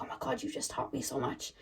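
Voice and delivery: a soft, amazed thank-you under the breath; whisper. Retold or imitated. Hugging the shadow, grateful.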